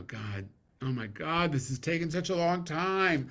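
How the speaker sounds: high, loud whine, slow at the start; mock exasperated. Accepting an award, whining at the audience in mock exasperation. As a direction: ham up being fed up with the wait. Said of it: "I was gonna say".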